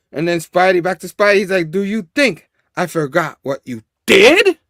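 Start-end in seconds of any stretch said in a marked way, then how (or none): 1.7-4.6 acted outrage exploding on "did"; angry confrontation. Spidey confronting Norman before punching him, angry.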